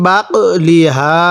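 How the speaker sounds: বাক লি হা read slowly, syllable by syllable; slow teacher's demo; slow spelled recitation. Shown as a demonstration. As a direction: model the joined reading patiently. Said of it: "এরকম করে পড়ব".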